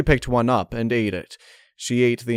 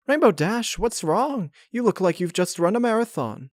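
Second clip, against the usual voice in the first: much higher, motherly baker; Mrs Cake voice; 11 semitones higher than usual. Acted. Mrs Cake asking Rainbow Dash what's wrong, higher and concerned.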